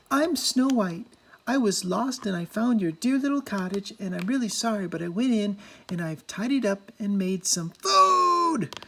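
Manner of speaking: put-on high, apologetic girlish voice. A narrator's reading. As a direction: explain sweetly and a bit sorry.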